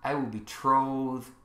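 low, soft, measured scripture reading; solemn preaching. Acted. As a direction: let each phrase settle quietly, with long pauses.

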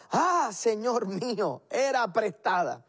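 mock wail in a high voice; acted cry of dismay. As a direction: cry out to your master, stretching the words. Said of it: "gritó diciendo".

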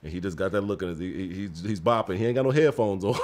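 ordinary delivery

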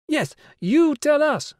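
high, loud, slow chant; chorus chiming in. Acted. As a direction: chime in together. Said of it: "a dozen other voices chimed in".

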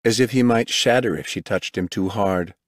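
plain reading voice

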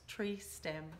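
ordinary delivery

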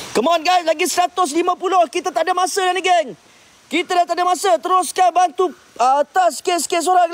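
high, driving push to the crew; urgent rallying. Real, not acted. Host rallies the crew to push on, high and urgent. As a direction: hurry them on, pumped.

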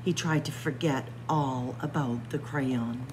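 usual speaking voice

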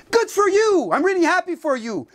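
high, mock-cheerful sarcasm; sarcastic. Real, not acted. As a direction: congratulate him with open scorn. Mocking an NLT endorser.

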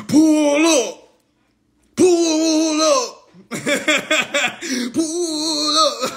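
loud mock-tough pull up; hammed-up tough-guy challenge; mocking imitation. Mocking Milk Dud's threat, loud mock-tough imitation. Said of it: "you say".

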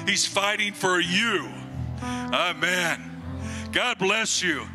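ordinary talk, nothing marked

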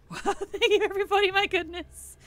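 high, loud, laughing thanks. Real, not acted. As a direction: laugh through the thank-you. Overwhelmed by gifts, laughing.

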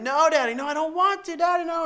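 put-on whining little girl. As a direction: mimic a scared kid begging Daddy. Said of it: "And she's whining".

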